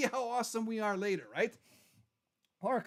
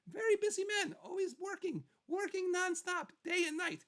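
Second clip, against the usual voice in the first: pitched way up, proud and bouncy; high boastful voice; 9 semitones higher than usual. Acted. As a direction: brag in a high sing-song. Boasting about his busy schedule.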